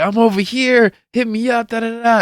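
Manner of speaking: high-pitched retold hail; imitated friend's call. Imitating friend calling him over.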